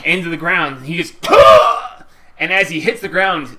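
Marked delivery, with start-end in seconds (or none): 1.2-2.0 sharp shouted grunt of effort; slam grunt. Acted. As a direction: spit it out like a body slam. GM voices wrestler slamming foe.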